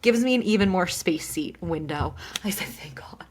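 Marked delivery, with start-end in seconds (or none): 2.7-3.2 hushed, breath-only aside; tiny whispered sigh of thanks; relieved whisper. Retold or imitated. Retold relief at getting a seat.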